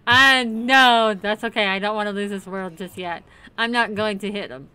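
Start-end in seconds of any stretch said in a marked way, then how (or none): none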